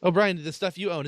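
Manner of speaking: usual speaking voice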